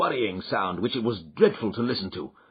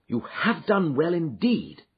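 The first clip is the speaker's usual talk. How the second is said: enthusiastic; 6 semitones higher than usual. A narrator's reading. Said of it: "cried Holmes with enthusiasm".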